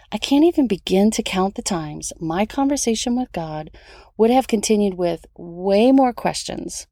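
ordinary talk, nothing marked

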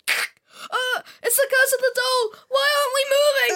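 very high, fast, loud panic; terrified shrieking. Acted. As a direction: shriek it in terror. Girl terrified.

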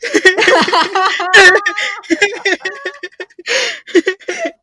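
long, high, loud laugh; helpless laughing fit. Real, not acted. Friend cracking up at a silly guess, helpless laughter. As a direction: crack up until out of breath.

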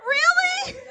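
loud, very high, laughing Really; giddy high-pitched Really; delighted squeal. Retold or imitated. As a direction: squeal it like a flattered regular. Flattered customer, squealed.

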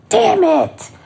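frustrated admission. Retold or imitated. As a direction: groan it out, caught and annoyed. Admitting the girl is right.

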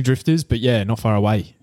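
ordinary delivery

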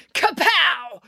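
high, punchy sound-effect word; sudden impact. A narrator's reading. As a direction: burst out like a sudden blow. Sudden sting in the story.

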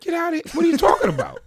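high, laughing protest; laughing disbelief. Retold or imitated. Boy laughing off his cousin's claim, high disbelief. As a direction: scoff through a laugh.

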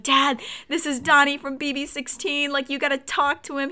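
high-pitched excited urging to dad; excited retelling. Retold or imitated. Retold excited call to dad.

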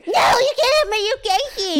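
high, loud mock of her friend's refusal. Teasing her best friend by mimicking her refusal, high and loud.

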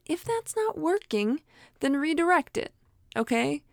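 ordinary talk

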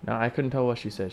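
ordinary delivery

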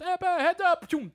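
high, loud call down the counter; called heads up. Retold or imitated. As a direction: sing out heads up to the other guy.

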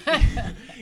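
loud, high laugh; burst of laughter. Real, not acted. Laughing while retelling Daniel's freakout. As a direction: crack up at the story.

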